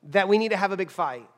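usual speaking voice

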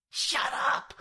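sharp hissed retort; spat whisper. A narrator's reading. Jack silencing George over his shoulder, spat whisper. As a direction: spit it out in an irritated stage whisper. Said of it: "I spat over my shoulder".

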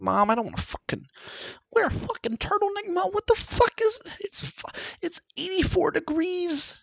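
put-on high whiny kid complaining to mom; whiny teen. Sulky kid whining at his mom about the turtleneck. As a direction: whine at mom like a sulky teenager. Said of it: "and I'm like".

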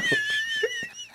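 very high, thin laugh; soft high-pitched giggling, trailing on; real laugh. Real, not acted. Laughing after joking about Mark, high and soft.

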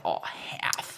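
unvoiced sly whisper. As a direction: whisper the price slyly. Whispered deal in retelling.

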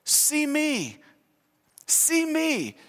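put-on high, soft little-kid voice; childlike plea. Adult pleading to the world, in a toddler's high little voice. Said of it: "trying to say to the world".

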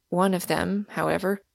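ordinary delivery

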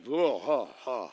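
imitating a sour face at a bitter bite; disgusted grunt. Tasting badly seasoned food.

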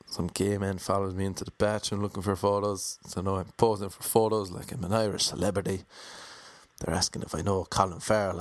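ordinary talk

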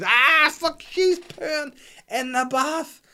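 high, loud, slow horrified groan; horrified dismay. Retold or imitated. Dad realizes the baby is pooing in the bath, horrified. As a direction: let the dread stretch out.

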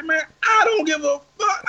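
high, strained retold shout; defiant shout back. Him shouting back at the driver, high and defiant. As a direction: reenact yelling it back way up high.

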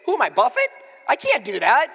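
high, loud disbelief; outraged at the cost; exasperated outcry; incredulous. Real, not acted. Outraged at price.

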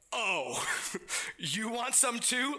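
lightly voiced Jesus, amused and daring; teasing Jesus. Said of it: "and then he goes".

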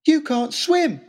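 high, loud blunt warning. Acted. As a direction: cut him off, loud and high. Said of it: "said Harry".

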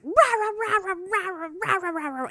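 mock ranting at an apologizing officer; mock angry ranting. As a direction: imitate a captain's furious rant as nonsense. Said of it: "he's just like".

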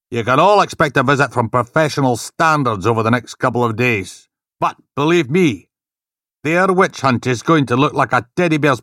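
loud, menacing boss threatening; threatening rage. Acted. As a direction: promise brutal revenge. Inspector threatening team.

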